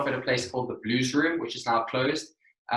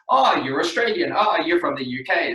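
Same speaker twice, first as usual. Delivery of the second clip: higher-pitched "oh" of mock recognition; mock delighted discovery; imitated wrong guess; 4 semitones higher, 3 dB louder than usual. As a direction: act like you have just placed the accent. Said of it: "because they either go".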